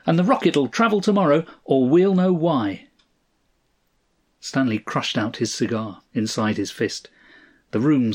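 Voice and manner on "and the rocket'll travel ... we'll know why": high-pitched, worked-up protest; heated, insistent outburst; angry shouting. A narrator's reading. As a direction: lay down the law, voice raised high. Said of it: "shouted the man".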